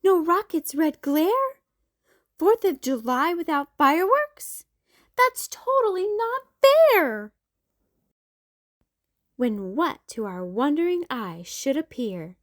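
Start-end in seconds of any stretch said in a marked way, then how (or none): 0.0-7.3 put-on high, louder kid complaint; whiny protest. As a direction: whine it's so unfair. Kids protesting cancelled fireworks.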